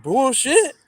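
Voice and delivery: scoffing retort. Retold or imitated. Caller retorts to the rep, high scornful. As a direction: spit it out in disbelief. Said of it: "I'm like".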